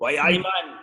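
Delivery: a quick stab at Geordie, drifting Jamaican; half-hearted Geordie catchphrase; mock Geordie, slipping into Jamaican; Geordie accent. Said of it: "If I try to do Geordie".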